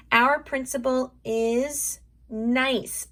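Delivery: slow, drawn-out sentence; slow sounding-out for young writers; stretched out. Shown as a demonstration. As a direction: say it slowly, stretching nice. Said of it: "let's stretch that out".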